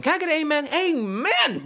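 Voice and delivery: high, loud preacher call for an amen; mock preacher. Host hams up a preacher calling for an amen, high and loud. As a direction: rouse the congregation like a pastor.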